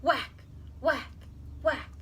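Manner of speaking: punchy sound-effect whacks; axe whacks. A narrator's reading. Narrator mimicking the axe on the trunk, punchy whacks. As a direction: strike the word like an axe blow.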